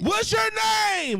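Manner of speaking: hammed-up, high, drawn-out holler; yelling whisperer. Shown as a demonstration. Mocking guys who yell in your ear in the club, yelled. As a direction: blast it slowly into someone's ear, mocking. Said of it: "they be yelling with the whisper".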